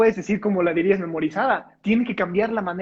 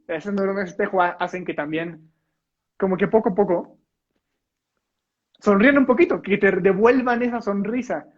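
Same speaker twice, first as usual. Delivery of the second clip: ordinary talk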